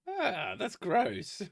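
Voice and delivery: disgusted. Real, not acted. Disgusted drawn-out reaction.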